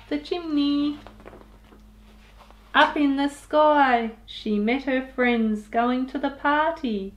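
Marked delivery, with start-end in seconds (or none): none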